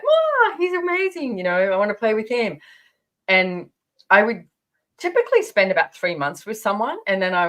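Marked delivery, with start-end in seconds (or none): 0.0-2.6 much higher gushing wow, fast; a starry-eyed teen swooning over a boy; mock infatuated gush. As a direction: gush like a smitten girl. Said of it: "be like".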